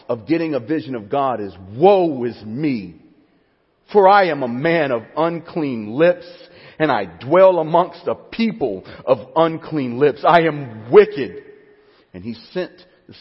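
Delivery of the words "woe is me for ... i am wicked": loud, slow anguished confession; louder pleading lament; voicing Isaiah. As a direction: cry it out like a broken prophet.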